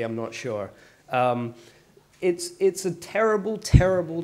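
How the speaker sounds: ordinary delivery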